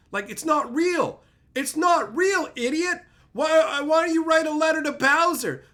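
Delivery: loud, high rant. Real, not acted. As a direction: yell it at them in disbelief. Ranting at fans.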